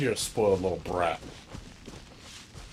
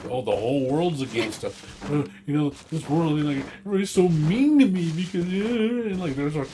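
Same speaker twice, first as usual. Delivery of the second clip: mocking whiny Karen; 6 semitones higher, 3 dB louder than usual. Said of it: "quote-unquote Karens".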